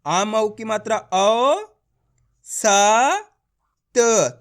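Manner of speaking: औ, स, त each drawn out separately; letter by letter. Shown as a demonstration. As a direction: spell it slowly, pausing between औ, स and त. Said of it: "आपको ये मेरे साथ साथ पढ़ना है".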